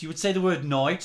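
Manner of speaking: ordinary talk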